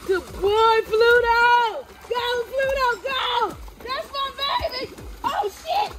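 high, thrilled praise for the dog; excited cheering. Real, not acted. Owner cheering on the dog as it swims, high excited praise.

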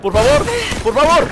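loud, rising, high-pitched begging; anxious pleading. Real, not acted. Player begs Lara not to fall, loud and frantic.

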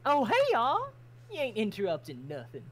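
high, loud Southern hello easing down; cheery Southern greeting. Acted. Farmer Crimson welcoming guests into the barn, Southern and cheery. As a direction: welcome them in a bright Southern twang.

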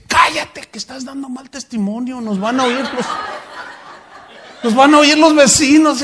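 high, loud hissing rebuke with a laugh; mock a hypocrite silencing his wife; a hammed-up furious husband; angry hush. Abusive husband hushing wife.

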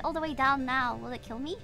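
ordinary talk, nothing marked

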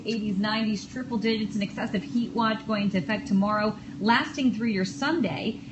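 upbeat radio weather report; weather forecast read. Acted.

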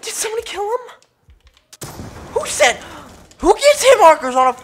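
high-pitched outraged surprise; shocked disbelief. Real, not acted. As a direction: squeak out the disbelief. Shocked by a kill.

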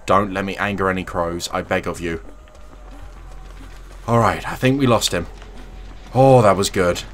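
ordinary talk

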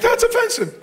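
high-pitched mock offense; hammed-up high voice of objection; offended listener. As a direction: squeal it like an affronted churchgoer. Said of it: "you're like".

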